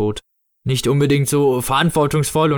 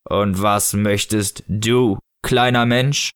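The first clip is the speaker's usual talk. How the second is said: slow, lower, solemn figure voice; Snow Father figure; 4 semitones lower, much slower than usual. Acted. Snow Father asking a small child's wish, slow solemn voice. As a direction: draw out each word ponderously.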